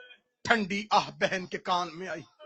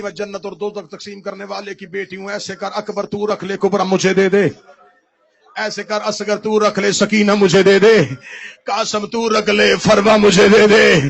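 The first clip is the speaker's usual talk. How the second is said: pleading wail; 13 dB louder than usual. Acted. Zainab begging Husain for his children, wailing. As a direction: plead and let it break into a cry.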